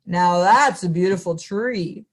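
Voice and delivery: hint of a gentle bear in awe; lightly voiced bear. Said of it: "said the bear".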